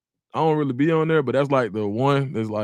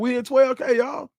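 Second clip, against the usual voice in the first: excited shout; 8 semitones higher than usual. Real, not acted. Streamer celebrating the subscriber milestone, shouting. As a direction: shout the win to the crowd.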